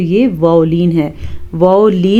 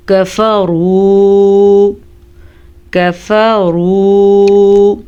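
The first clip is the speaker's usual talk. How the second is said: slow, drawn-out tajweed chant; Quran recitation; much slower than usual. Shown as a demonstration. Teacher reciting for students. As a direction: intone the Arabic slowly.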